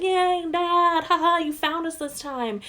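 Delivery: very high, bright mock child voice; cheery kids. Little girls greeting their furious dad, squeaky delighted voice. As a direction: squeal it like a happy five-year-old.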